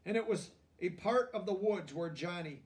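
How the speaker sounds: lively picture-book narration; reading to children. A narrator's reading. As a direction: read it like a bedtime story to kids.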